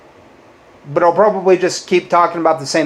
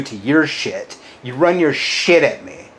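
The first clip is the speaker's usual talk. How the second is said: ordinary talk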